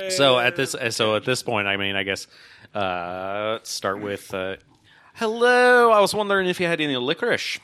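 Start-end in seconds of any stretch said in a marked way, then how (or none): 5.1-7.6 high, loud, chirpy greeting to a shopkeeper; in-character customer. Acted. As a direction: pipe up as an over-eager customer. Player greets baker in character.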